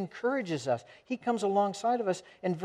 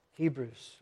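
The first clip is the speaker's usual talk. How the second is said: usual speaking voice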